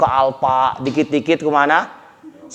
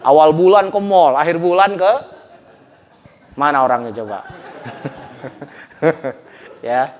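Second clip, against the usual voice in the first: ordinary talk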